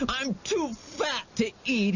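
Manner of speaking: high, tearful pleading; frightened pleading. A narrator's reading. Terrified boy pleading with the monster, high and tearful. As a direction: whimper out an excuse.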